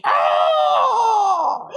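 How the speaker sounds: very high, drawn-out little wail; tiny scream. Acted. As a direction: scream like someone tiny falling away. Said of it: "you hear a tiny".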